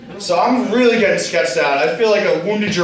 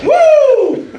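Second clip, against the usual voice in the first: high, loud drawn-out "woo"; party cheer; excited whoop; 6 dB louder than usual. Real, not acted. Cheer on stage with beers.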